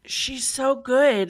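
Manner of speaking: slow, drawn-out swoon. Real, not acted. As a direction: draw it out, lovestruck. Swooning over character.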